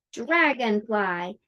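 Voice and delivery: slow Dragonfly, three clear beats; clapped syllables. Shown as a demonstration. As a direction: clap out Dragonfly slowly. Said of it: "How many claps?".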